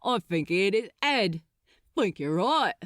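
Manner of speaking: rough worker. Acted. Ship workers talking.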